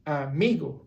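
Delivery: amigo stressed on "mi", a-MI-go; stress on second-last syllable. Shown as a demonstration. Teacher models the amigo rule, amigo stressed on "mi". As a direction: lean on the "mi" in amigo. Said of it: "in this case would be mi".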